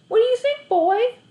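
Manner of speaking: high, loud eager question to the dog; bright boyish call to the dog; talking to the dog. A narrator's reading. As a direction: lift the voice right up for the dog. Narrator voices boy talking to dog.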